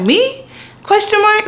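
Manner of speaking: very high doubtful me; unsure. Retold or imitated. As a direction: squeak it like you can't believe it. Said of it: "I'm always very much like".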